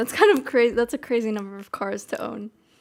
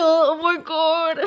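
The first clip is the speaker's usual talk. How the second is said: loud, drawn-out laughing exclamation; cracking up; 5 semitones higher, 4 dB louder, slower than usual. Real, not acted. Streamer cracking up at their own "different and special" claim, laughing. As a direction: burst out laughing, pitch climbing.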